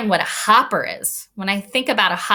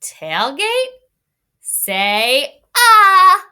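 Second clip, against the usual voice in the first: tailgate said higher, ah loud and drawn out; bigger voice; 10 semitones higher, 6 dB louder, much slower than usual. Shown as a demonstration. Teacher reading the tailgate line aloud, ah made big and loud. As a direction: make the big word bigger. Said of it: "I made my voice a little bit bigger".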